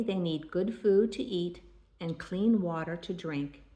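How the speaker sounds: usual speaking voice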